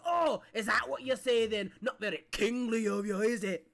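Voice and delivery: loud, mocking jab; teasing pirate voice. Cheese pirate teasing the king, loud mocking voice. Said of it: "And he goes".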